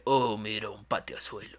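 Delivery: slow, low, soft musclehead drawl; gym bro. Retold or imitated. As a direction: talk like a slow, buff meathead. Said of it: "Como le-, como lento, güey, de".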